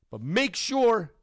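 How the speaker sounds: ordinary talk, nothing marked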